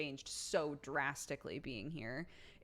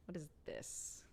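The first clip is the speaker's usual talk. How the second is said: breathy mock disgust, much softer; 11 dB quieter than usual. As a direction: whisper it as a grossed-out cat. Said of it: "a disgusted T B".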